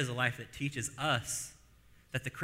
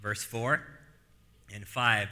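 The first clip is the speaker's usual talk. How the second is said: normal talking voice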